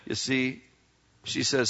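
usual speaking voice

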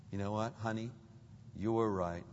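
low, soft husband's confession; humble apology. Acted. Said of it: "that reluctance to say".

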